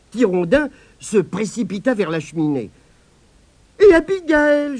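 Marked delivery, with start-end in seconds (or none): 3.8-4.8 put-on high, loud anxious child's wail; whimpering.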